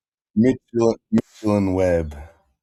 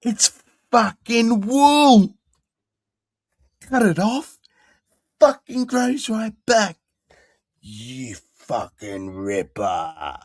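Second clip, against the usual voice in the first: farmer impression; 12 semitones higher, 4 dB louder, slower than usual.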